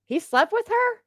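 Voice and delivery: shocked at hearing he slept with her. Real, not acted.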